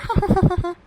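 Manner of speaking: high, loud giggle. Acted. Wife giggles after boasting of her praise, loud high giggle. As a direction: burst into a pleased giggle.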